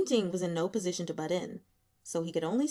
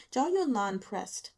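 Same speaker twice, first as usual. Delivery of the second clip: usual speaking voice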